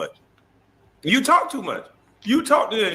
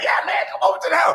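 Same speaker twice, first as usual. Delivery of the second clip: mock excited invite; 11 semitones higher, much faster than usual. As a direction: gush like an eager fan, hammed-up.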